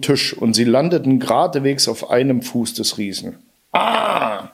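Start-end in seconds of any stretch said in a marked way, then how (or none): 3.7-4.5 high, sharp yelp of pain; a stubbed-toe giant crying out high; giant's pained cry. A narrator's reading. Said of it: "schrie der Riese".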